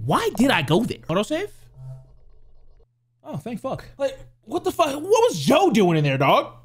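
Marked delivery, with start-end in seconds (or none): none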